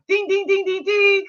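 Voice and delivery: sung high, like a game-show correct-answer bell; quiz bell ding. Retold or imitated. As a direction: chime it out way up high. Quiz-show bell for right guess.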